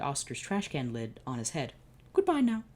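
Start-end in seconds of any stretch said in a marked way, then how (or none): 2.1-2.6 high, curt brush-off; grouchy dismissal. Acted. Oscar sends the two men away, high curt goodbye. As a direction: send them packing, pitched up.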